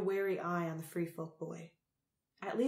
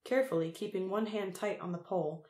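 ordinary delivery, nothing marked

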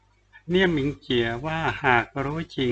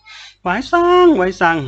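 high, loud, drawn-out call; calling after someone; 8 semitones higher, 6 dB louder, much slower than usual. A narrator's reading. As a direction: shout his name after him, stretching it out. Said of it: "ตะโกนตามหลัง". (ตะโกนเรียกตามหลัง ลากเสียงยาว)

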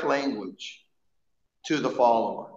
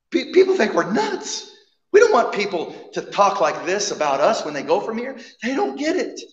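disciples pleading; 6 semitones higher, 5 dB louder than usual. Retold or imitated. Disciples voiced pleading with Jesus.

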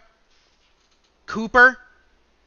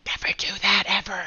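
whispered warning; 3 semitones lower, 3 dB quieter than usual. Real, not acted. Warning aside.

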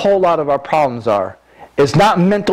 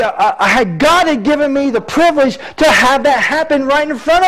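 raised, fervent preacher voice; preaching at full force; 8 semitones higher than usual. Acted. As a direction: preach it out to the congregation.

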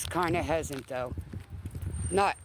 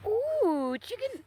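much higher sing-song pet voice; 14 semitones higher, much slower than usual. Real, not acted. Owner coaxing a timid dog closer in high baby talk.

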